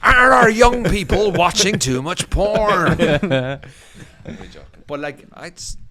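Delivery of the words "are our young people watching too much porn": high, loud, very slow radio-presenter voice; Stephen Nolan impression. Mocking a radio host for co-hosts, slow and loud. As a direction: ham up a pompous phone-in host. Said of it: "Stephen Nolan".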